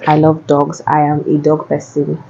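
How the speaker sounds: ordinary talk, nothing marked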